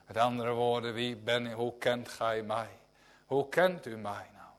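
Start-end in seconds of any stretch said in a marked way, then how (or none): none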